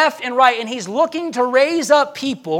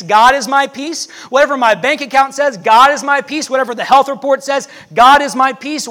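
louder, building declaration; preaching refrain; 2 semitones higher, 5 dB louder than usual. Real, not acted. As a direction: preach it with rising fervor.

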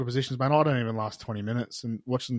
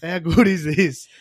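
loud, slow, higher boast; excited crowing; 5 semitones higher, 8 dB louder, slower than usual. Retold or imitated. Second bloke celebrating his record with the boys, crowing. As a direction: stretch it out and belt it like a winner.

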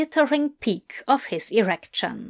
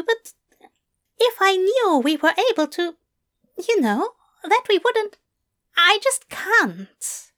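high, fretful fussy voice; anxious angel voice; 10 semitones higher than usual. A narrator's reading. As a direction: fret in a high voice.

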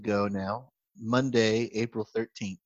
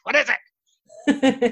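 mock groggy wife; 13 semitones higher, 4 dB louder, much slower than usual. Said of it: "She's like".

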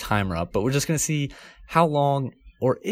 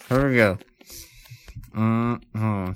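wet, muffled words round a mouthful; mouth full of marshmallows. Real, not acted. Host warning co-host about saliva, mouth stuffed and wet. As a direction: talk through marshmallows and pooling saliva. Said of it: "I'm getting a lot of saliva".

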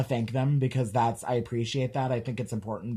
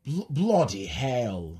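slow, halting British "Bloody hell"; weak British accent attempt; slower than usual. Shown as a demonstration. Host copying cohost's British "Bloody hell", slowly. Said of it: "mine's not so good".

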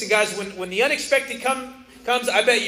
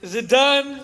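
acted frazzled question; 6 semitones higher, 8 dB louder than usual. Acts out the picture's frazzled question.

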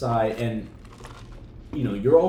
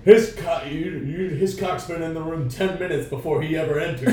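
higher gruff scout impression; mock old scout; 5 semitones higher, 5 dB louder than usual. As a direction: quote it like a crusty old scout. Quoting scout line from movie.

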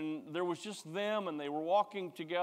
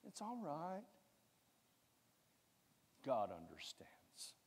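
soft, slow tempting whisper; slow sly devil whisper; devil's whisper; 12 dB quieter than usual. Retold or imitated. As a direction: whisper it like a devil soothing you. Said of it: "Then the devil goes".

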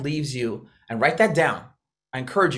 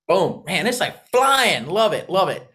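high, loud burst of excitement; excited cheer; 4 semitones higher, 4 dB louder than usual. Real, not acted. Coach watching chat replies pour in, excited cheer. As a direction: whoop the word out, stretched.

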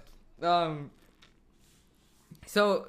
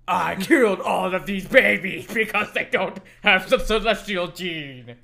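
villain voice; 5 dB louder than usual. Retold or imitated. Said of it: "scenes like that where it's like".

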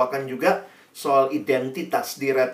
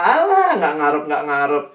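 laughing it off; 4 dB louder than usual. Retold or imitated. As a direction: wave it away as a joke. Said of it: "cuman ketawa lah". (meremehkan dengan nada bercanda)

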